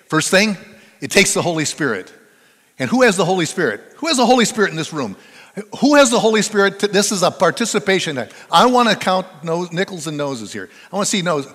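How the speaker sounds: loud, high preaching to the room; fiery preaching. Acted. Preacher pressing the congregation to own the Holy Spirit, fired up. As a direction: rouse the congregation to answer.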